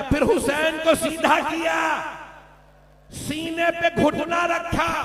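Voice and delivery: high, loud, slow keening that breaks into a shout; wailing lament. Acted. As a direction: cry it out in anguish. Granddaughter wails the martyrdom to Prophet.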